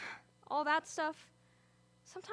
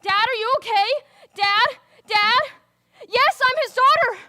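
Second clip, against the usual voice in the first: loud, high, frantic cries for help; panicked shouting; 8 semitones higher, 14 dB louder than usual. Acted. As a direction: yell in panic as a parent crashes. Father's oxygen drops, she panics.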